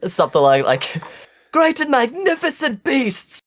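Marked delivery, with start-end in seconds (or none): 1.5-3.4 high, grand heroic proclamation; epic shout. Acted. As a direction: declaim it to the dragons. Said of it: "You're just shouting epicness at the dragons".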